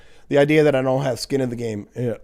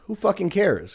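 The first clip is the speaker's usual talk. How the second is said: ordinary talk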